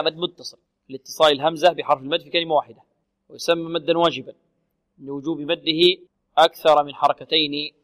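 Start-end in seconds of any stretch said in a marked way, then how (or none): none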